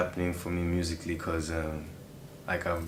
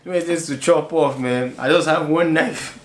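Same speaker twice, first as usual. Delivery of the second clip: laughing; 10 semitones higher, 8 dB louder than usual. Real, not acted. Laughs while answering.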